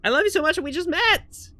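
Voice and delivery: high-pitched, smitten gushing; gushing character voice. Acted. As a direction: gush over a brand-new friend. Character gushes over toy monkey.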